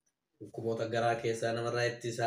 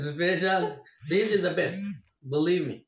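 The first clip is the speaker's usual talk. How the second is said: excited; 7 semitones higher, 9 dB louder than usual. Real, not acted. Excited food praise, laughing.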